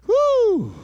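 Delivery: sudden high joyful whoop. Real, not acted. Preacher whooping to the congregation, high joyful whoop. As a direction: let out a high church whoop.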